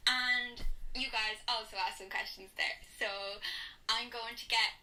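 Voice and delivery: shy and hesitant, held back; nervous old clip voice. Pretend. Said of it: "I can't even talk".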